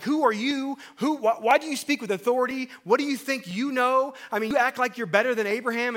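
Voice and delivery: high, loud challenging Pharisees; indignant Pharisees. Retold or imitated. Pharisees challenge Jesus, high and loud and indignant. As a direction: demand to know who he thinks he is. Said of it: "the Pharisees are like".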